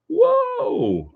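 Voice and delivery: big, very high whoa; amazed at how far away the viewer is; surprised whoa. Real, not acted. Spotting a viewer from New Zealand, amazed whoa.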